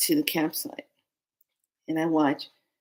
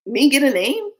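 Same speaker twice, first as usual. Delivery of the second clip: high, loud incredulous question; acted disbelief; 8 semitones higher, 6 dB louder than usual. Asked about getting a name, acts incredulous. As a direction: act taken aback at the very idea. Retold startled reply to question.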